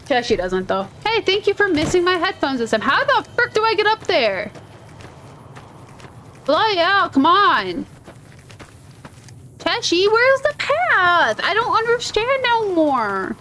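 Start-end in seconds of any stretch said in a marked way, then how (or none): 1.0-4.5 high-pitched complaint at the game; thrown-up-hands frustration, pitched high; exasperated gamer. Real, not acted. Griping at the game mid-play. 6.4-13.3 high, drawn-out gamer complaint; lost and whining about it; exasperated wail. Real, not acted.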